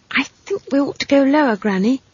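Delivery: put-on high, quick girl's voice; pressing little-girl plea; urgent child. A narrator's reading. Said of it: "she said urgently".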